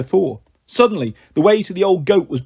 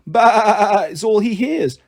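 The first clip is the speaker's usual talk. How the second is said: slow drawl; 4 semitones higher, much slower than usual. Acted. Sheep drawling slowly.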